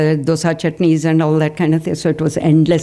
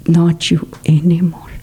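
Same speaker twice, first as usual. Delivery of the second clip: whispered repeat. Shown as a demonstration. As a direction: breathe the words out as a gentle whisper. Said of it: "See, so precise".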